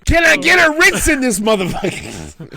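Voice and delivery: high loud cracker demand; mock demanding shout. Co-host shouting as the parrot for a Ritz.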